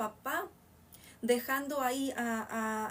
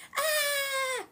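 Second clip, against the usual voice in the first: long, loud, very high acted scream; grito con exclamación; 14 semitones higher, 5 dB louder than usual. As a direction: hold a piercing possessed cry. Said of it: "Aquí está con exclamación". (grito agudo y fuerte de endemoniado)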